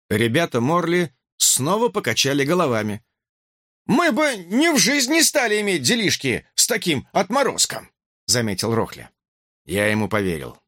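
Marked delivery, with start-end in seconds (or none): 3.9-7.9 высокий грубоватый уличный голос; с уличной бравадой и неприязнью; уличный говор. Acted. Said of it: "В его речи улица встала во весь рост". (уличная речь с презрением)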